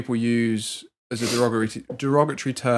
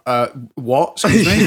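mock outrage; 2 semitones higher, 7 dB louder than usual. Telling a friend what he nearly said, mock shocked. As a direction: play the stunned shop worker. Almost said to customer.

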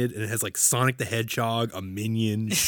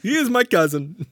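high, loud hammed-up Middle Eastern accent; mock Middle Eastern accent; 14 semitones higher, 8 dB louder than usual. Disney cast member recommending the jamboree, mock Middle Eastern accent. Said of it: "use his real accent".

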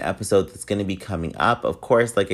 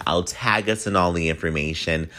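ordinary talk, nothing marked

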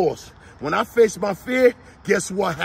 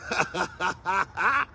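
a short scornful laugh; brushing it off with a laugh; mocking laugh; 5 dB quieter than usual. Real, not acted. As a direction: laugh off the temptation. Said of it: "I laugh at that whisper in my ear".

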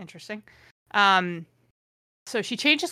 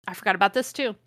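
ordinary talk, nothing marked

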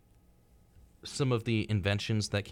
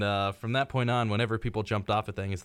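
normal talking voice

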